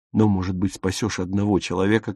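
usual speaking voice

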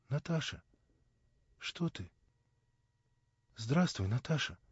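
shy, almost afraid to speak; timid. A narrator's reading. Young man timidly greeting his beloved, quiet and shy. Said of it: "робко проговорил он".

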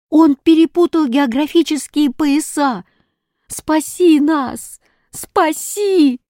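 put-on high, urgent children's cries; high, slowing calls for help; desperate plea. A narrator's reading. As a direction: cry for rescue, stretching the last words. Children begging hero for rescue.

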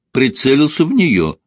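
usual speaking voice

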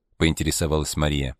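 ordinary delivery, nothing marked

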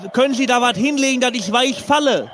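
mock panic. Parachutist prank call continues.

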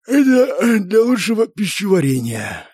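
slow, high, stretched yawn. A narrator's reading. Pyromaniac justifying his silence after dinner, yawning. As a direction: talk through a big drawn-out yawn. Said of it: "зевнул пиромант".

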